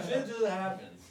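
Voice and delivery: ordinary delivery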